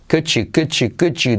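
"Could you" linked as "cou-joo"; Y becomes J. Shown as a demonstration. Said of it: "se convierte en J".

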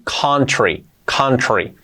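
"country" said as "cantri", first vowel like A; corrected vowel. Shown as a demonstration. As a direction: open A in the first syllable of "country". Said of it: "no es country ni country".

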